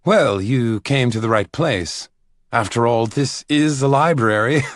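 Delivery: pleased with his own joke; Caleb joking. Acted. Caleb jokes with a visitor, playful.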